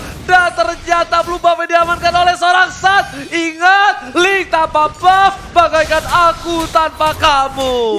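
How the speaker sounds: loud, high hyped shouting; excited esports caster. Real, not acted. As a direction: yell the play-by-play. Hype caster shouting the play.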